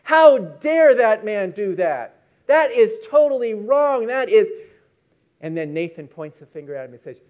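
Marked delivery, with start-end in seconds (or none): 0.0-4.5 loud, slow, rising outrage; David hears the story and erupts in loud anger; indignant outburst. Retold or imitated. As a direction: shout it like a king who can't believe what he's hearing. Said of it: "he's getting more and more angry".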